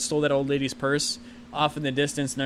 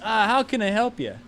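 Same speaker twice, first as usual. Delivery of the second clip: put-on higher-pitched Italian accent; 6 semitones higher than usual. Italian pizzeria owner greeting a customer, Italian accent. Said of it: "like, in an Italian accent".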